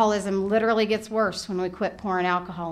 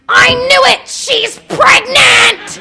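high, loud, slow shriek of alarm; panicked shout; 14 semitones higher, 11 dB louder, much slower than usual. Retold or imitated. Mother's inner alarm as her son wants to talk, a high slow shout. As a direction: scream it like the worst news ever. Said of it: "what happens in my head? It goes".

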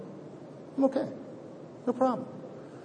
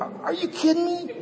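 high, slow, laughing disbelief; incredulous; 13 semitones higher than usual. Retold or imitated. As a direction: stretch it out in shock. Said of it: "but I'm thinking".

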